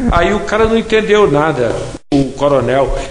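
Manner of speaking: ordinary delivery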